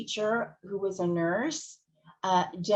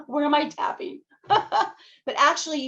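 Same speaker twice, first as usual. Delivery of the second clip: laughing; 7 semitones higher, 5 dB louder than usual. Real, not acted. Laughing at imagined office confusion.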